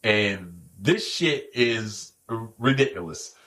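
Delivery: stuttering impression. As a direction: stretch and stall the words like Earl. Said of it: "This is how he talking".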